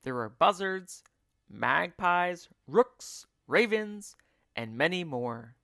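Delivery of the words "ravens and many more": usual speaking voice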